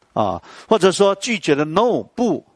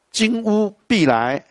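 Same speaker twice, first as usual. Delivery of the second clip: poem recitation; slower than usual. Shown as a demonstration. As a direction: lift the first line then settle. Recites poem lines slowly. (吟诵诗句)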